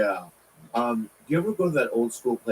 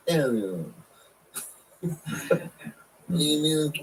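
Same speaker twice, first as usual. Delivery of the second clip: Italian accent; 4 semitones higher than usual. Shown as a demonstration. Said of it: "I'll do it in the accent".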